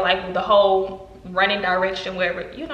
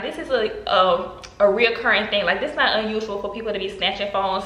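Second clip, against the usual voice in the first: normal talking voice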